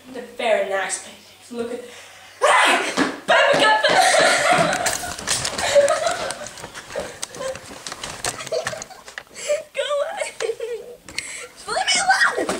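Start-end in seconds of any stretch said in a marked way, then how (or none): 2.4-3.8 high, loud hammed-up yelp of pain with a laugh; mock pained yelp. Hammed-up pain over paper cut. 3.8-9.7 hammed-up wail. Fake meltdown over paper cut. 9.7-12.5 mock tantrum. Fake tantrum after paper cut.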